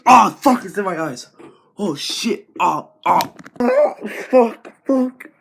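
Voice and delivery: loud high yelling in pain; pained screaming. Acted. Sanitizer in his eyes, high pained screams.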